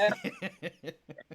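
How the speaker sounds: a high burst of laughter at the memory. Real, not acted. Laughing high while retelling the Walmart scene. Laughing at his own story.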